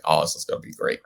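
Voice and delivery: whispered, dry sarcasm; sarcastic whisper. Retold or imitated. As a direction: breathe it out wryly. Sarcastic reaction to acid news.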